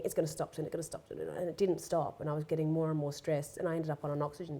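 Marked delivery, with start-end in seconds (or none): 0.7-1.2 the same words whispered under the breath; whispered repeat. Retold or imitated. Recalling the panic attack on the plane, whispered repeat. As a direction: whisper it to yourself as if pleading. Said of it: "I kept thinking".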